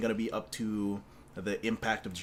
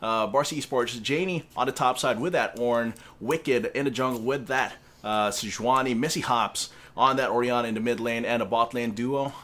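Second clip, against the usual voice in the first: loud, higher lineup announcement; old-school shoutcaster; 4 semitones higher, 9 dB louder than usual. Acted. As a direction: belt out the lineup like a caster. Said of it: "Run down the teams".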